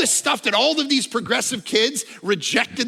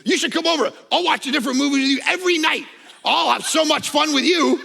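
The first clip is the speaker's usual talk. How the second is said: higher, louder, quick and eager invitation; eager dad; 8 semitones higher, 5 dB louder than usual. Retold or imitated. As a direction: gush like an overeager dad. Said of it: "I'm like".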